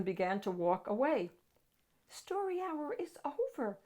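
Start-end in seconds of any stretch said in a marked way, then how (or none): 2.1-3.8 put-on high, soft little girl; child's voice, much higher and softer. A narrator's reading. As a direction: speak like a small child gently explaining. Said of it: "a little girl told him".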